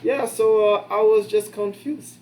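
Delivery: high, loud, slow mock Filipino accent; imitating an old Filipino coworker in a bad Filipino accent; terrible Filipino accent. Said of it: "Terrible Filipino accent".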